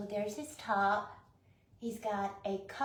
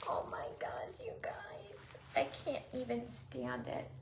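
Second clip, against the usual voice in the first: breathless whisper of awe; awed whisper; 9 dB quieter than usual. Real, not acted. Overwhelmed unboxer whispers her awe to the viewers. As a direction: whisper it, too overwhelmed to speak.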